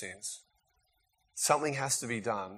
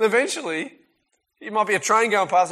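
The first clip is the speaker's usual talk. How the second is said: ordinary talk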